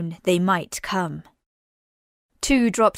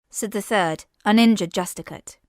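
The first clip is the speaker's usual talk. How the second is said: plain reading voice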